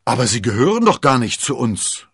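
objecting. Acted. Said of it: "wandte Porthos ein".

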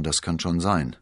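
ordinary delivery, nothing marked

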